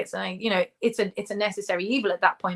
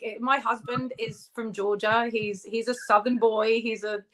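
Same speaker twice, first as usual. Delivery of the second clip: ordinary delivery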